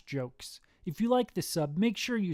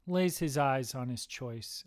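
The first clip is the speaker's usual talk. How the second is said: ordinary delivery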